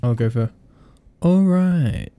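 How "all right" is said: higher, laid-back Owen Wilson drawl. Pretend. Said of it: "In the voice of Owen Wilson".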